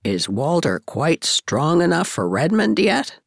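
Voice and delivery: low, concerned question; mildly anxious. A narrator's reading. Miss Cornelia asking after Walter, mildly anxious. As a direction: ask with a touch of worry. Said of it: "queried Miss Cornelia anxiously".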